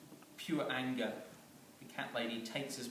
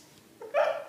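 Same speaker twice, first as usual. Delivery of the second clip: high squeal of laughter; 12 dB louder than usual. Real, not acted. Teller laughs mid-sentence.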